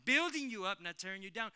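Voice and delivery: high, loud "Building" hammered home. Shown as a demonstration. Preacher driving the refrain home to the church, shouting it. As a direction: climb on "Building you up" and shout it.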